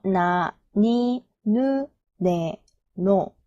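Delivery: 나, 니, 누, 네, 노 drawn out, one syllable at a time; syllable-by-syllable demo. Shown as a demonstration. Teacher showing the N row to learners, syllable-by-syllable demo. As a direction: recite 나, 니, 누, 네, 노 slowly with N onsets. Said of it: "N 발음이 붙어서".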